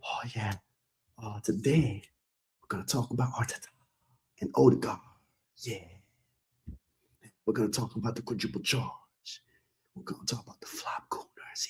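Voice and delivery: soft, low, breathy whisper; soft low whispered pundit intro; seductive whisper. Shown as a demonstration. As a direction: hush it like a bedroom whisper. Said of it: "imagine me speaking to y'all in this type of voice".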